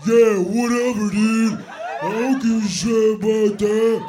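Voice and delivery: loud, put-on dopey guy voice; mock deep-guy voice. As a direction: imitate a girl mocking her boyfriend, bellowing. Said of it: "Every girl gets in this deep voice".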